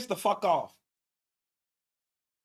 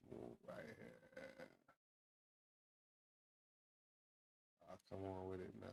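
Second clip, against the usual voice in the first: whispered muttering; 14 semitones lower, 30 dB quieter than usual. Real, not acted. Muttering while finding the spot.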